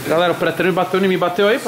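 loud, pumped-up vlogger shout; hyped gym vlog. Acted. Vlogger shouting to followers, loud and pumped. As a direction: hype the camera after the pre-workout.

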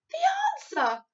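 eager, triumphant exclamation; excited cry. A narrator's reading. Sophie answering the puzzled giant, excited cry. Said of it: "cried Sophie".

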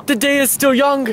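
a hint of dramatic poetry reading; mock poetic. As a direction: recite it like a mock-solemn poem. Mock poetic ode to camera.

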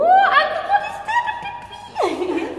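high laughing squeal, drawn out; laughing shock. Real, not acted. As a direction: laugh through the question. Laughing at naked statue.

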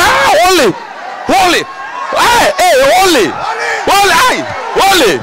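high, loud shouted holy; shocked shout. Acted. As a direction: cry out holy in amazement.

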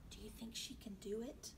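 hushed, anxious wondering. A narrator's reading. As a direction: ask softly, almost whispering with worry. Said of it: "her eyes full of anxiety".

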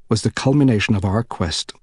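ordinary delivery, nothing marked

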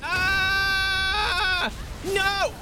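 long high shriek, then a cried no; panicked scream. Real, not acted. Hit by hammer in game.

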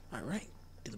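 sly unvoiced whisper. Real, not acted. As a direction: whisper it like a cheeky joke. Whispered joke aside.

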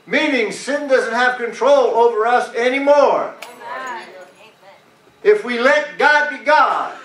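full-voiced preacher driving each phrase home; preaching. Preacher urging the church to let God be God, preaching. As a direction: preach it out with long, punched key words.

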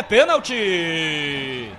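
long drawn-out penalty shout; penalty call. Real, not acted. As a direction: stretch out the call, excited. Narrador grita pênalti. (pênalti arrastado e empolgado)

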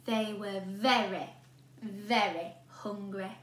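plain reading voice

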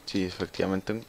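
ordinary talk, nothing marked

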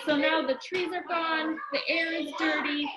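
plain reading voice

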